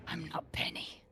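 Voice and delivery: whisper. Acted. Penny's other self replies.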